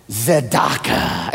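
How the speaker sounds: tzedakah said slowly with a rasp from the throat; throaty tzedakah. Shown as a demonstration. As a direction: stretch out tzedakah, deep in the throat. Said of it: "you cannot say it without saying it like that".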